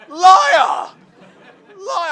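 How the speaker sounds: loud, high laughing cry of liar; laughing accusing shout. Retold or imitated. Comedian calling himself out, laughing shout. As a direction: yell it at yourself.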